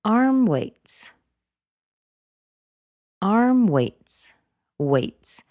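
"Arm" high, "weights" dropping down; emphasize "Arm", then let "weights" fall; emphatic drop through "arm weights"; falling intonation. Shown as a demonstration. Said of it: "Weights also drops down".

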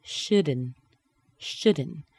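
"shouldn't" ending on a nasal N, the T dropped; two careful takes of "shouldn't" without the T; missing T. Shown as a demonstration. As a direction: say "shouldn't" as "shud-n". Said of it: "but listen".

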